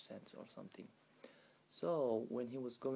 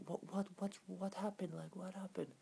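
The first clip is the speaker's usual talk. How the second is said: high-pitched, repeated startled questions; alarmed neighbors; 8 semitones higher than usual. Retold or imitated. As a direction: imitate startled neighbors rushing in. Alarmed neighbors rush in after scream.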